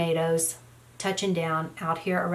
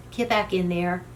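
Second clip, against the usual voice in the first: normal talking voice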